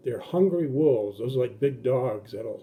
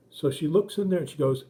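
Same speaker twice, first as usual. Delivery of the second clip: ordinary delivery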